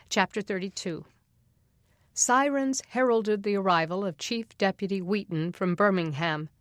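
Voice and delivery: usual speaking voice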